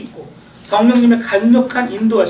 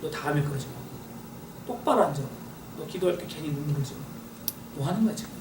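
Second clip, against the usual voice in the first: lower, softer scolding commands; stern inner voice; 7 semitones lower, 13 dB quieter, faster than usual. Acted. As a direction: scold yourself quietly like a strict parent. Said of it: "이성이 내 육체에 또 명령을 해요".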